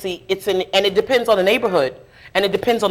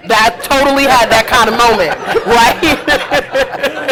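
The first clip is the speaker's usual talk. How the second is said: laughing through the line, a bit louder; 6 semitones higher, 4 dB louder than usual. Real, not acted. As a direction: giggle at the memory. Laughing at her own story.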